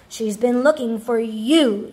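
usual speaking voice

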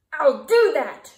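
loud grouchy screech. A narrator's reading. Grouchy ladybug storming off, loud screech. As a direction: fling it back, loud. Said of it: "screeched the grouchy ladybug".

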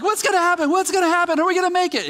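high, frantic worrying questions; anxious. Retold or imitated. Fans pacing the garage before the big hit, high anxious fretting. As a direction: voice the panic of fans pacing the room. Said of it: "living in the anxiety of the moment".

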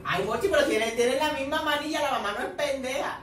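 loud, high, rising outburst; heated exclamation. Real, not acted. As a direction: burst out in loud protest. Heated outburst about the mother.

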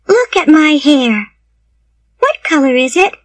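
put-on high child's voice, confiding; chatty little girl. A narrator's reading. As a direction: pour it out as a talkative orphan girl.

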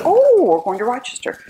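mock surprise. Mother's delighted realization.

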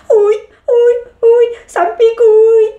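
high, loud pleading cry. Acted. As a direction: beg through the stinging. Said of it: "supplia-t-il".

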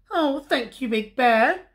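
high, small cub voice; Little Bear. A narrator's reading. Little Bear thanks Big Bear for the lantern, high cub voice.

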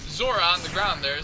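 usual speaking voice

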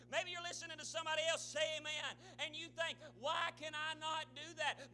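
full-force preaching. Real, not acted. Preacher speaks to doubters in church, fervent preaching. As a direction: build hard toward the devil's verdict.

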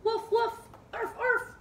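high-pitched little dog woofs; cheery high barking; playful dog barks. Pretend. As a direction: bark like a small dog in pairs. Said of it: "To downward dog".

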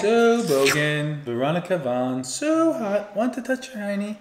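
high squealing movie quote; silly put-on voice. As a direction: ham it up, ending in a squeal. Quoting movie line in put-on voice.

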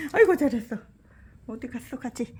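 loud, high praise for a pet; talking to a dog. Real, not acted. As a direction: cheer the dog, high and bright.

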